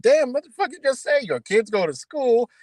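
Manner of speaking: a touch of loud outrage; shocked disbelief. Retold or imitated. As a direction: sound floored by what you just heard. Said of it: "I'm like".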